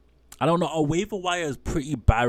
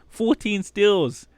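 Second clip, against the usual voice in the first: high, loud and slow shocked question; shocked disbelief; 10 semitones higher, 5 dB louder, much slower than usual. Real, not acted. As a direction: gasp it out in disbelief. Repeats the shocking stat.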